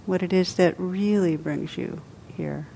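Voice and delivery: ordinary talk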